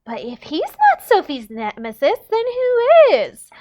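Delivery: high, loud, drawn-out disbelief; theatrical shocked gasp, stretched at the end; gasped question. A narrator's reading. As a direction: build to a dramatic, high-pitched question. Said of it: "Annadelle gasped at him".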